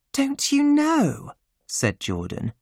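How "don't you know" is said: put-on much higher hushed young woman; high, urgent hush; Jordan's voice. A narrator's reading.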